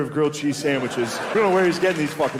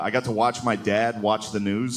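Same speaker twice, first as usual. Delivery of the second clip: ordinary delivery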